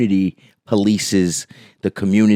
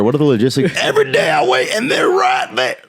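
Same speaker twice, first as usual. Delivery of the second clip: bigot character voice; 6 semitones higher than usual. Pretend. Bigot character sketch continues.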